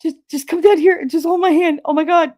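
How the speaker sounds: high, pleading re-enactment of panic; retold panic. Fallen mother asking son to hold her hand, retold panic. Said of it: "I was, like, I was petrified".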